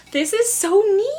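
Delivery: high-pitched gushing; thrilled, high excited cry; real delight. Real, not acted. Delighted by the coin bank.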